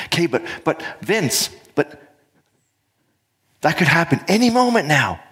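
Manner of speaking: high, stammering worried voice; anxious inner voice. Acted. Inner voice warning the speaker of danger, high and anxious. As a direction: fret urgently, pitched well up. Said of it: "That voice is going".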